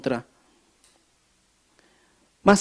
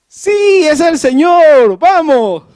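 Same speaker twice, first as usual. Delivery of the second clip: much higher and louder, slow on señor; joyful exclamation; 9 semitones higher, 9 dB louder, much slower than usual. Acted. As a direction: shout it out in joyful faith. Pedro que debió gritar con fe. (Pedro exclama con alegría, mucho más agudo y fuerte)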